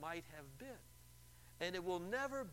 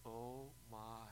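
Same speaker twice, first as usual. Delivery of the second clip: soft, sinking voice; dismayed caller; 4 semitones lower, 8 dB quieter than usual. Retold or imitated. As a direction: let the regret drop in. Caller realizing her mistake.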